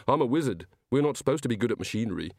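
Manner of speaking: plain reading voice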